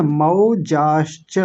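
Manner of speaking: मौजाश्च said slowly with a full मौ diphthong; careful teacher demo; slow syllables. Shown as a demonstration. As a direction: stretch out मौजाश्च, open the मौ. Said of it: "मौ बोलेंगे".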